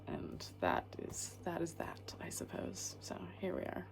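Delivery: drops to a whisper, trailing off; soft unvoiced resignation; hushed whisper. Real, not acted. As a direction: murmur it under your breath, almost to yourself. Alone indoors, winding down.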